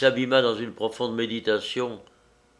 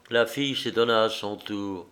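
plain reading voice

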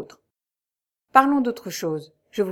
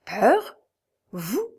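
astonished questions, sharply rising at the end; incredulous exclamation. A narrator's reading. Raoul hearing the commander admit fear, incredulous. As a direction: question it in mild disbelief. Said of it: "s'écria Raoul".

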